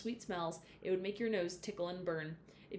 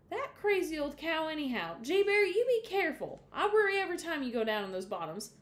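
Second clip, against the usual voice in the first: higher, anxious mother's voice; worried Mama; 8 semitones higher, 4 dB louder than usual. A narrator's reading. Mama warning Jay Barry about the bottoms, higher and worried. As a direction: fret over the boy, pitched up.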